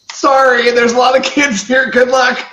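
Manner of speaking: high, loud, breezy send-off; mock calling out. They leave him behind, mock calling out. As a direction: call it out as a hammed-up farewell. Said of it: "and they're like".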